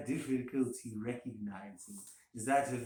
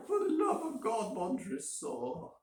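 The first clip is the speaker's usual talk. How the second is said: desperate plea; 10 semitones higher than usual. A narrator's reading. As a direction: beg for your life in terror. Fortunato's last desperate plea.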